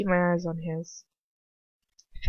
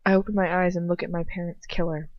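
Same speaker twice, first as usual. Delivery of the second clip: usual speaking voice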